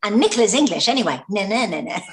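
imitated playground jeer, rattled off high and loud; mock taunting. Actress mimicking co-star's parting dig at the producer, mock taunting. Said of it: "It was like".

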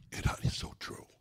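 whispered aside of a listener. Acted. Preacher voicing a listener agreeing, whispered. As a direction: murmur it under your breath.

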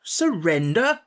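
can't believe his ears; the word stretched out in amazement; astounded echo. Acted.

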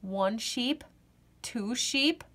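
plain reading voice

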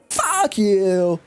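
high-pitched mock jeer; drawn-out silly high insult; odd put-on voice. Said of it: "what that was even supposed to be".

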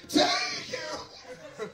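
piercing falsetto mock shriek; imitated shout. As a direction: squeal it like the shouting girl. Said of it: "The girl with the shout".

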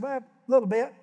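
sheepish reply. Retold or imitated. Preacher answering the fisherman, coyly. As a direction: play it coy about the catch. His own retold reply.

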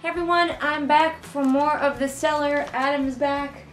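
ordinary talk

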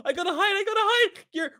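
ordinary talk

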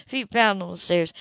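usual speaking voice

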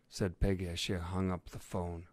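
ordinary delivery

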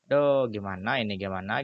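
ordinary talk, nothing marked

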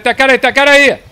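high, loud alarmed yell; urgent shout. Real, not acted. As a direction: shout the warning at full voice. Alerting teammate to nearby enemy. (grito de alerta)

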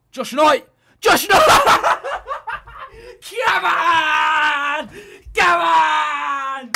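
loud, high shouts with a laugh; ecstatic goal celebration. Real, not acted. Gamer celebrating a lucky goal, shouting wildly. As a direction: scream with joy at the goal.

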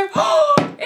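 loud, high dramatic gasp; horrified gasp. Acted. Papa finding one daughter hitting another, horrified gasp. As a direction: gasp in exaggerated horror. Father bursts in on beating.

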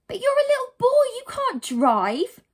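very high, loud disbelief; incredulous scolding. Acted. Heroine challenges a small boy at the wheel, incredulous scolding. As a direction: protest loudly, drawing out the end.

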